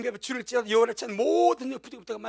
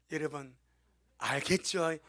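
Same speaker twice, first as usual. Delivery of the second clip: normal talking voice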